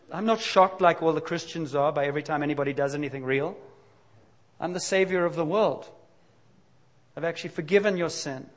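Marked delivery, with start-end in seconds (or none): none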